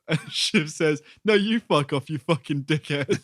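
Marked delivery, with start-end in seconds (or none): 1.2-3.2 raised-pitch retort, starting loud; mock quarrel. Podcaster retelling Shiv swearing back at George, mock quarrel. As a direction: throw the insult back in playful imitation. Retold quarrel between two characters.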